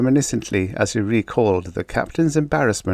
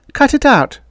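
very high, loud plea; acted urgent appeal; pleading; 8 dB louder than usual. A narrator's reading. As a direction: beg them to stop, urgently. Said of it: "she pleaded".

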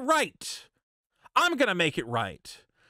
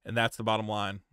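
mock apology; 8 semitones lower, faster than usual. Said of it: "You know".

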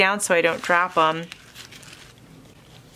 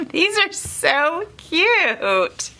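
much higher, slow and giggly; laughing; 10 semitones higher, much slower than usual. Real, not acted. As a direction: laugh through the words, delighted. Laughing at pun card.

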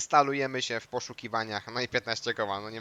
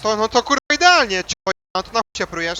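angry; 9 semitones higher, 12 dB louder than usual. Acted. In-game fight.

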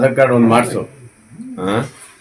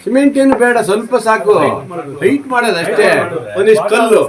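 raised, higher voice giving orders; emphatic instructing; 6 semitones higher, 4 dB louder than usual. Real, not acted. Telling workers how to raise the house height, loud and emphatic. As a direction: lift the voice to direct the work. Directing building work to others.

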